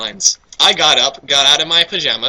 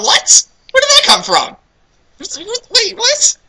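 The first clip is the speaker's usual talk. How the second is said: higher, baffled what; confused; slower than usual. Real, not acted. Reader meets an unknown character, baffled what. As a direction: react in disbelief.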